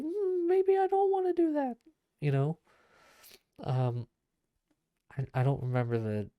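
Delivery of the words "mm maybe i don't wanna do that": squeaky high put-on voice of a hesitant person; high, wavering mock voice, second-guessing; cartoonishly high hesitant voice; mock reluctant voice.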